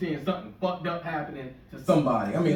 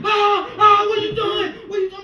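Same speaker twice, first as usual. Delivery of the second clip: much higher, much louder frantic yelling; panicked scream; 12 dB louder than usual. Retold or imitated. Teen cousin screaming at the driver who ran a light, panicked yell. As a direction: shriek it like your driver just ran a light. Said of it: "he starts screaming".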